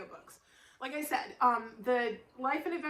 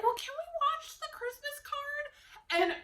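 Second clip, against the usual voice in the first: parents asking; 11 semitones higher, slower than usual. Retold or imitated. Parents voiced asking hopefully.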